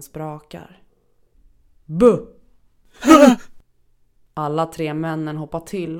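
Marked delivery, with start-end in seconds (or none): 1.8-2.3 loud, sharp boo; sudden shout. Acted. The hidden narrator scaring the three men, a sudden loud boo. 3.0-3.6 high, loud cackling laugh; shrill cackle. Acted. The narrator laughing at the startled men, shrill and loud.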